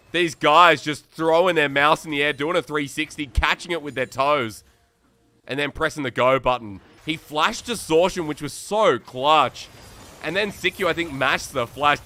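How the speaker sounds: fast, rising esports casting call; live play-by-play. Real, not acted. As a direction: ride the action like a live caster. Solo caster calling the game live.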